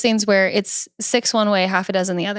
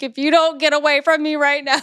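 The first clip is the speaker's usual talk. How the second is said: mock threat; 6 semitones higher than usual. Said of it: "I'm like".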